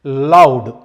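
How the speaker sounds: teacher shouting loud to show it; loud voice demo. Shown as a demonstration. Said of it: "loud voice అంటే".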